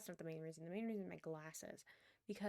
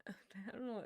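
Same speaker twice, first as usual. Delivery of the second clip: normal talking voice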